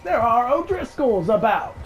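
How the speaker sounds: hammed-up Western outlaw voice; cowboy voice. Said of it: "I just wanna talk like them".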